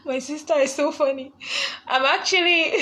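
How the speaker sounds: high, giggling through the words; can't stop laughing at her sister. Real, not acted. Host giggles at her sister's comment in chat, laughing.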